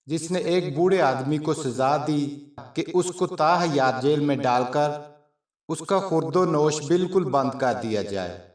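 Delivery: ordinary delivery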